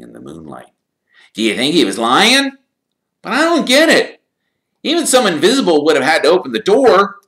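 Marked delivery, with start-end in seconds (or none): 1.3-7.2 put-on high, loud boyish complaint; lightly voiced Ron. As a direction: grumble like a worn-out schoolboy.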